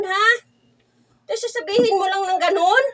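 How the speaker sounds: normal talking voice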